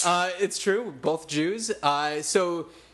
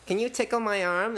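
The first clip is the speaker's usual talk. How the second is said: man doing a woman's voice; 5 semitones higher than usual. Retold or imitated.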